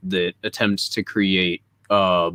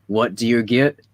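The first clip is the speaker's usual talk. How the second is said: ordinary talk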